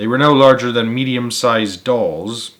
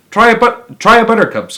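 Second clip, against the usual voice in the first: high, loud eager cry; cried out; 10 semitones higher, 6 dB louder than usual. Acted. Wonka urging the visitors to taste buttercups, high excited cry. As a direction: call out to tempt the guests. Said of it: "cried Mister Wonka".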